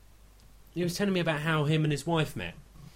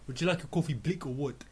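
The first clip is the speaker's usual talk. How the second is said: clipped South African coffee offer; South African accent; 3 dB quieter than usual. Shown as a demonstration. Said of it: "in a South African accent".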